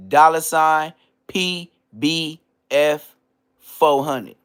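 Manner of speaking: spelled out slow and loud for slow listeners; slow repeat. Shown as a demonstration. Repeating the cash tag for slow listeners, slow and loud. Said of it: "Let me say it again for the slow listeners".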